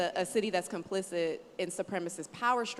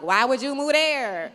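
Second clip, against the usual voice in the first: much louder, higher mock disbelief; incredulous family; 6 semitones higher, 12 dB louder than usual. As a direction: throw the question out in loud disbelief. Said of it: "family and friends were like".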